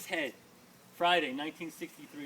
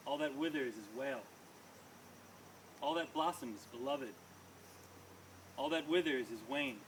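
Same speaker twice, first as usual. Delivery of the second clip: poem recitation; 3 semitones lower, 4 dB quieter than usual. Acted. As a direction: let each line fall with weight.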